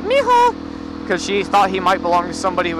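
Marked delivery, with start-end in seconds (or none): 0.0-1.1 very high sing-out, imitating wife; imitated high-pitched coaxing call; excited pet call. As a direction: call a dog in a squeaky-high voice. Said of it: "it's all about your tone".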